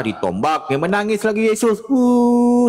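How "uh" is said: high, loud fake sob; mock crying sound. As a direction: let out a hammed-up whimper. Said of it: "nangis dia".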